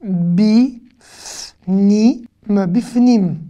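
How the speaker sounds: drawn-out syllables with clear gaps. Shown as a demonstration. Teacher sounding out a new word for learners, syllable by syllable. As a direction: sound it out slowly, each part separate.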